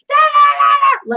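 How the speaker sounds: high, loud mock yell of an angry nun; imitated nun yelling. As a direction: shriek it like the furious nun. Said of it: "She starts yelling at me".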